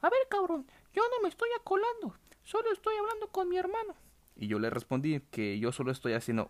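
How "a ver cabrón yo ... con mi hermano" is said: high, sharp mocking lady voice; angry lady voice. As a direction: mimic an irritated older woman, much higher. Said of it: "se me encabrona toda y me empieza a decir".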